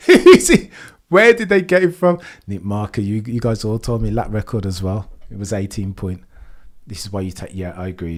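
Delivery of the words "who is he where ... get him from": high, loud, laughing through it; laughing disbelief. Real, not acted. Streamer to chat about Verstappen, laughing in disbelief. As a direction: laugh at the sheer absurdity.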